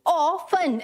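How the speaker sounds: often in two separate parts, "aw ... fun"; often slowly split, T silent. Shown as a demonstration. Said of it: "A W".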